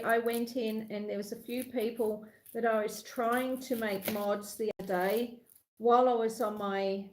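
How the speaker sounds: ordinary delivery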